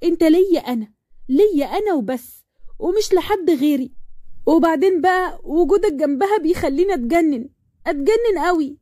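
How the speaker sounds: high, possessive and worked up; heated jealous girlfriend in full flow; jealous outburst. Acted.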